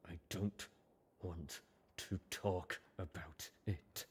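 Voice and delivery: barely holding together, slow and soft; brittle voice. Acted. Hurt colt refusing to talk, brittle and soft. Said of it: "in a brittle voice".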